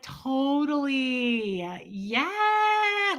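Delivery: forced cheerful overacting; too eager to seem fine; over-the-top agreement. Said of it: "it was over the top".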